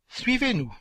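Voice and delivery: sharp high stern command; stern mistress's command; austere order. A narrator's reading. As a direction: snap it out with cold authority. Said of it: "ordonna madame Marie avec austérité".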